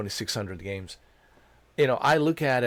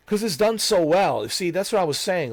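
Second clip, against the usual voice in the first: excited outburst; 9 semitones higher, 6 dB louder than usual. Real, not acted. Gushing about the game.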